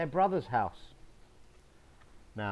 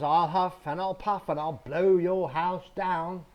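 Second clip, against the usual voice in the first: higher and louder, booming; loud wolf threat; 6 semitones higher, 8 dB louder than usual. Acted. Big bad wolf booms his threat at the wooden house. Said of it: "The big bad wolf says".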